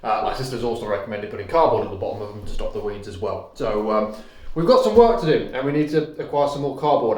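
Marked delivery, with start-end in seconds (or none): none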